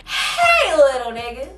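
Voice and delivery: mock the generous stranger hailing someone; imagined helpful stranger. Voices the imagined great Samaritan.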